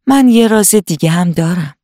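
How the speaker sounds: ordinary delivery, nothing marked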